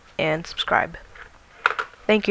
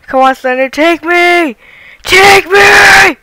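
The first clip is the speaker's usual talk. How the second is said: building to a high shout; panicking gamer; 9 semitones higher, 12 dB louder, slower than usual. Real, not acted. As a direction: taunt the monster, louder each time. Taunting Slender in game.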